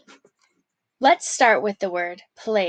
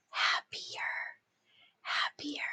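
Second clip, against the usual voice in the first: soft whisper of the word; 15 dB quieter than usual. Shown as a demonstration. Teacher whispering the dictation word to the class. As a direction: hush it right down. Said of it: "dictation drill, word repeated".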